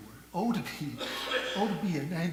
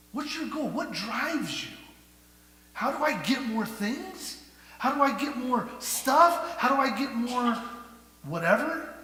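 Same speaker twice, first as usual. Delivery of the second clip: mock greedy asking; 8 semitones higher, 8 dB louder than usual. Preacher voicing a greedy mindset.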